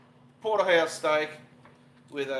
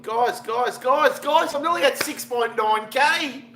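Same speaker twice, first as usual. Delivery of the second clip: higher and louder, building, laughing through it; thrilled call to his viewers; yelling with real delight; excited shout; 8 semitones higher, 8 dB louder, slower than usual. Real, not acted. Viewer count milestone on stream.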